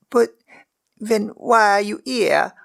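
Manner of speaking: put-on high, slow girl's voice, blurted out; a frightened little maid blurts her question; Megan blurting. A narrator's reading. Servant girl blurts a question at the prisoner, high and slow. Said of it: "Megan blurted".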